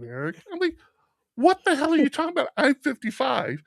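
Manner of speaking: normal talking voice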